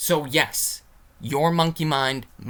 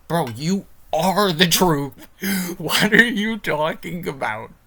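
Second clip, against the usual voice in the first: emphatic scolding; 5 semitones higher, 6 dB louder, slower than usual. Real, not acted. Scolding a billionaire on camera.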